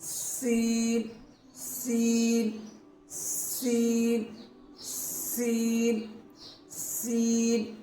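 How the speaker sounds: سین with a long whistling hiss on the s, drawn out slowly; سین said slowly with a sharp sibilant hiss; hissing seen. Shown as a demonstration. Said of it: "وہ دانتوں کے قریب لانا ہے".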